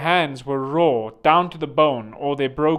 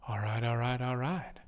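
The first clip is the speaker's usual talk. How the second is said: actor impression; 3 semitones lower, 9 dB quieter than usual. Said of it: "what's that actor?".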